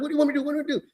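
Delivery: high-pitched imitation of a pleading man; mock a cornered teacher pleading; flustered teacher.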